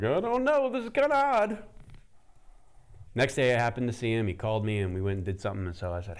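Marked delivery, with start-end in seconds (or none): 0.0-1.6 high, loud, hesitant put-on voice; unsure self-quote. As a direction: squeak it out, unsure and puzzled. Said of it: "And I'm like".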